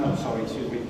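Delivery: ordinary talk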